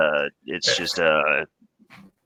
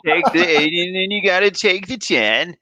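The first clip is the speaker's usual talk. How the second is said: put-on much higher, louder California accent; breezy Californian surfer voice pitched way up; Cali accent; 11 semitones higher, 5 dB louder than usual. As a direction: California dude accent, giving directions up high. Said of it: "do a Cali accent".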